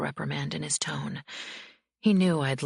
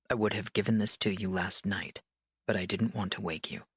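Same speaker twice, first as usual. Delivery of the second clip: lower, calm, controlled man's voice; cool authority, unhurried; stern mafia boss; 7 semitones lower than usual. A narrator's reading. Dante's card read in his voice.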